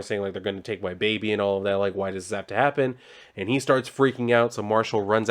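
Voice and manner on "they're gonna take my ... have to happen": usual speaking voice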